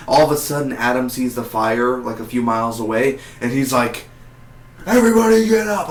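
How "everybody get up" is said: high, loud urgent yell, much higher and louder; alarmed shout to wake the camp; retold scream. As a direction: yell it to rouse everyone, fast. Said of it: "he literally just screamed out loud".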